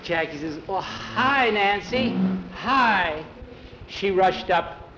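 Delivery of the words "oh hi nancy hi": higher-pitched happy hello; glad, lilting hi; delighted greeting. A narrator's reading. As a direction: greet with pleased surprise. Jackie greets Nancy at reunion.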